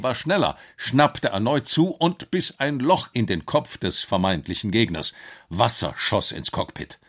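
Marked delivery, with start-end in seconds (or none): none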